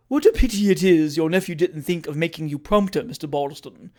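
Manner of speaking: ordinary delivery, nothing marked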